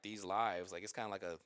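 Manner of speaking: normal talking voice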